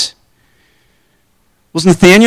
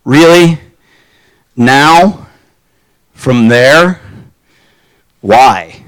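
skeptical; 5 semitones lower, much slower than usual. Acted. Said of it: "He's skeptical".

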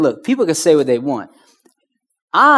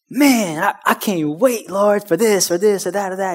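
eager, higher voice pouring out plans; excitedly blurting dreams to God; excited praying; 4 semitones higher than usual. Retold or imitated. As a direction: gush to God like a kid. Excited prayer quote.

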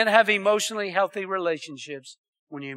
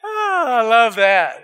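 loud, drawn-out gleeful cry; long relishing "oh" sliding down; delighted exclamation; 3 semitones higher, 6 dB louder, slower than usual. Real, not acted. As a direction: savor the joke out loud, slowly. Enjoying his own punchline.

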